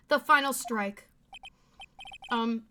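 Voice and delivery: ordinary talk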